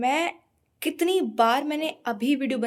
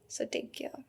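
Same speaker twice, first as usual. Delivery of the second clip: whisper; 11 dB quieter, much slower than usual. Real, not acted. Signing off to viewers.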